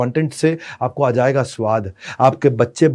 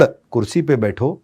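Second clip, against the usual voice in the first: gentle instruction; 3 semitones lower, slower than usual. Shown as a demonstration. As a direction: invite the child to sit gently. Shouted vs calm contrast.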